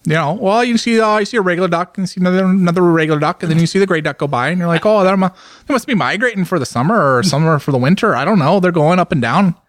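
a light Minnesota-Scandinavian lilt on the vowels; Minnesota accent. Pretend. Friend riffing on a Fargo trip in a light Minnesota accent. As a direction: play a folksy Upper Midwest local. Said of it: "Is that like a Canadian accent".

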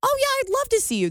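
high, quick fake enthusiasm; mock delight. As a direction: gush it fast and high like forced cheer. Acting her fake delight.

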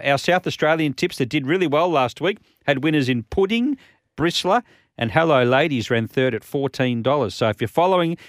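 usual speaking voice

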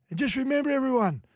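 high, husky interview voice; Sammy Backo impression. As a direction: imitate a husky-voiced footballer, pitched right up. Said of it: "His husky voice".

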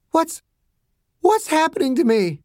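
high, faltering, scared question; scared patient's high voice; frightened girl. Acted. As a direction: ask it fearfully, stumbling on the start. Sick girl asks what is happening.

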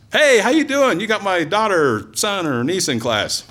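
high, eager hey; friendly greeting. Retold or imitated. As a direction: greet him like an old friend. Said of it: "I go to the post office".

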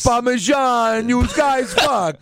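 hammed-up New York-Italian accent; New York-Italian caricature. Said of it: "We don't fucking talk like that".

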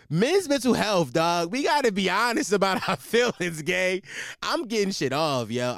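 shouted high and loud, half laughing; loud hyped call to the guys; loud hype. Real, not acted.

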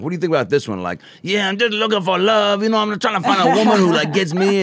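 high, fast lovesick dating-show voice; put-on lovelorn voice. In the limo, testing a put-on voice on the driver. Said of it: "playing with voices".

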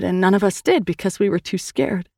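usual speaking voice

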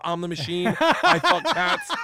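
high, drawn-out boastful yell; rising to a high, slow holler; imitated drunken-night yelling. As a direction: yell it out like a showboat. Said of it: "and I'm yelling out".

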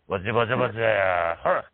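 imitated low, raspy baritone. Wrestler startles a steakhouse cook, imitated raspy baritone. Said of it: "real baritone, raspy voice".

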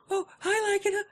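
high, breezy mock girl's voice; mock girl greeting. Co-host mimics the girl mixing up his name, high and chirpy.

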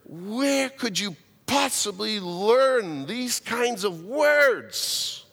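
put-on slow, stretched scolding of the kids; a preacher chiding swearing kids, pleading and slow; earnest scolding. Scolding children about swearing.

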